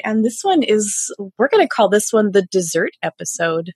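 ordinary talk

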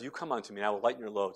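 soft, gentle voice of God; tender hush. Acted. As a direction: hush it like comfort to a child. God's tender words voiced.

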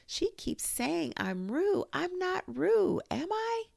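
very high pleading squeal; squeaky, insistent little creature; crying out. A narrator's reading. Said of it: "cried Piglet".

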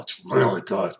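reverent whisper about bottled water; hushed mock awe. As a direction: whisper it like a secret delight.

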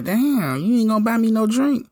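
high mock girly voice; mimicking the woman. Voicing the woman at the bar.

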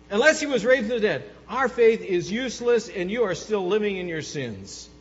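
urgent pulpit emphasis; fervent preaching. Acted. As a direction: drive the warning home. Preaching at full force.

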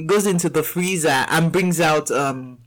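ordinary talk, nothing marked

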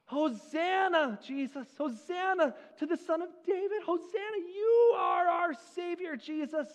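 put-on high, ringing child's cry of praise; children crying out. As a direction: call out hosanna like an excited child. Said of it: "Children, are crying out".

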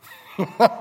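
a loud burst of laughter; real loud laugh; hearty laugh. Real, not acted. Laughs at his own joke.

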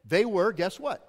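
ordinary talk, nothing marked

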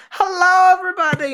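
Goldmember voice. Retold or imitated. Goldmember rolling out on skates, high loud camp greeting. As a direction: greet the crowd like a bizarre showman. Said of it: "he's like".

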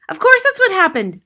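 high, loud exclamation; mother crying out. A narrator's reading. Mother seizes on the explanation, crying out. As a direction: cry it out, exasperated. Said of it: "the mother cried".

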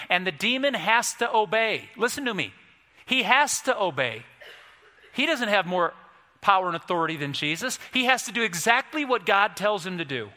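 high, insistent preacher's delivery; forceful preaching. Real, not acted. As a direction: hammer the point home from the pulpit.

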